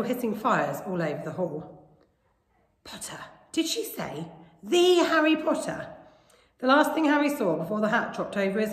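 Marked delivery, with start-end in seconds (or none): 2.8-4.3 soft, hushed gossip; whispers. A narrator's reading. Whispers round the hall as Harry steps forward, hushed. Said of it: "whispers suddenly broke out". 4.6-5.9 high, slow, incredulous question; astonished. A narrator's reading. Students gossiping about the famous new boy, astonished.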